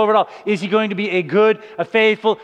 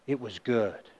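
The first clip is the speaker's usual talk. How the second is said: soft God voice; 9 semitones lower, 10 dB quieter than usual. Acted. Quoting God's verdict softly.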